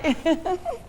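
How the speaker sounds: high burst of charmed laughter; delighted laugh. Real, not acted. Host hearing the child say she loves dad, delighted laugh.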